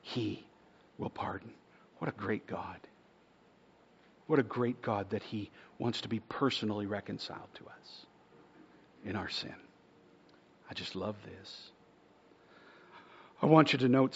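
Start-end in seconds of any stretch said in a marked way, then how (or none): 0.0-1.5 hushed emphasis. Acted. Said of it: "For the text says". 2.0-11.7 soft, breathy wonder; quiet reverent marvel; hushed awe. Real, not acted. Hushed awe after text.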